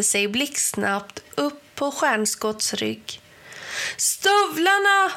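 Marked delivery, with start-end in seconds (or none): 4.0-5.1 high urgent shout. A narrator's reading. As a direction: yell it to your friend. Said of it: "skrek Ben".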